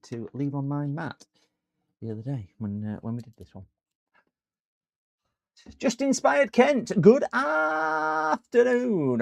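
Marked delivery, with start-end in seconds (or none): 5.8-9.2 high, loud, drawn-out welcome call; big announcer-like hail to a newcomer; hollered greeting. Pretend. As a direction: sing out the hello, stretching the words wide. Calls out greeting to a viewer.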